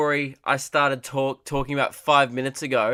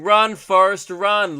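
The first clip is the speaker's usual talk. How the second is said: louder, higher mock yell; imitated street yelling; 6 semitones higher, 7 dB louder, much slower than usual. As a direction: heckle a sprinter from across the street. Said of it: "other dudes just start yelling".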